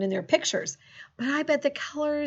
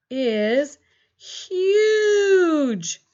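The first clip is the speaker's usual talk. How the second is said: excited drawn-out huge; 6 semitones higher, 6 dB louder than usual. Shown as a demonstration. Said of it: "listen close".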